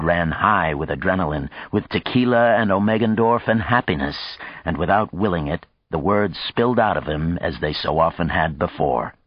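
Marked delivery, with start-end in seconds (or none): none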